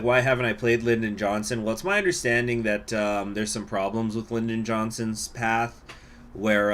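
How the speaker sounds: normal talking voice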